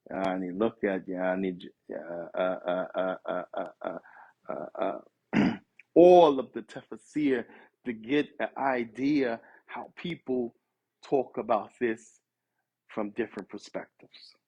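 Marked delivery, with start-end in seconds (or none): none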